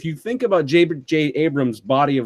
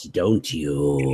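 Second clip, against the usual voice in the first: tempting devilish whisper; 12 semitones lower, 5 dB quieter than usual. Pretend. Echoing the tempting line.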